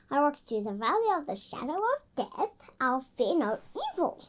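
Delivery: soft, quick recitation; quiet child recitation; reciting from memory. Shown as a demonstration. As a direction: murmur the verse you memorised.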